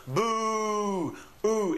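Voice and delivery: high, drawn-out playful "boo"; children's Halloween boo. Acted. Teacher imitating children at Halloween, long high ghostly boo. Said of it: "at Halloween, when the children go".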